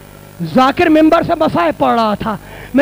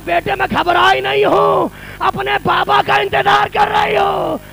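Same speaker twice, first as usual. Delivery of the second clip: wailing lament; 6 semitones higher, 4 dB quieter than usual. Acted. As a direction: wail to her dead father. Zainab greets her father, wailing.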